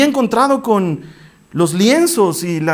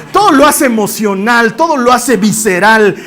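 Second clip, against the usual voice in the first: louder, punchy, hammering each word; emphatic declaration; 5 semitones higher, 6 dB louder, slower than usual. Real, not acted. Preacher describing Peter's passion to the congregation, loud and emphatic. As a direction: drive it home with force.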